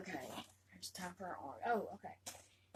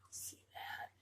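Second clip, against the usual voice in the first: whisper; 9 dB quieter than usual. Real, not acted. Showing doll to viewers.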